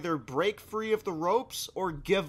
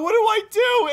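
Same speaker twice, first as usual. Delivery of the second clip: high, loud panicked wail; hammed-up tearful cry of helplessness; retold crying; 11 dB louder than usual. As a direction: wail it in mock-tragic despair. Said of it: "And I'm actually crying".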